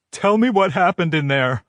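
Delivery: high, tearful demand in her voice. A narrator's reading. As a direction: demand it through tears. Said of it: "I demand".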